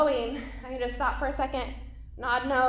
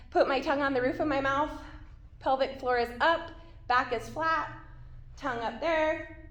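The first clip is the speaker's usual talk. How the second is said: normal talking voice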